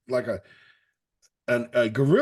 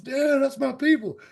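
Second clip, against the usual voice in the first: high-pitched mock bragging; punk kid's boast; 12 semitones higher, 3 dB louder than usual. Punk kid taunting the alpha chimp, imitated boasting. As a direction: keep showing off like that kid. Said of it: "and that guy's".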